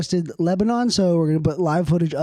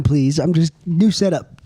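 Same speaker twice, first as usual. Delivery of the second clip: ordinary delivery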